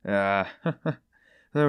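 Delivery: short laugh. A narrator's reading. Porphyry laughs mid-speech.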